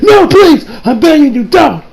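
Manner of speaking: high, loud acted begging; desperate pleading. Begging Kira not to hit detonate, desperate pleading. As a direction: cry out a retold frantic plea.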